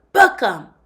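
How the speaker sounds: put-on loud, commanding child cop; girl playing cop loudly ordering her partner; barked order. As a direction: order it like a TV sheriff. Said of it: "the girl ordered another boy".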